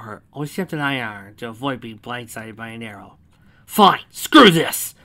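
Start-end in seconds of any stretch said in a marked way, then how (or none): 3.7-4.9 loud, high, slow outburst; frustrated yell. A narrator's reading. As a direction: yell it as if you've had enough. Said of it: "Lyra yelled in frustration".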